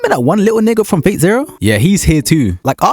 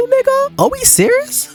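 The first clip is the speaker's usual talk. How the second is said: incredulous squeal; slower than usual. Real, not acted. Reacting to a fire tornado.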